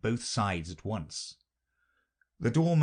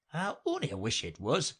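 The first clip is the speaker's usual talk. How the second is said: usual speaking voice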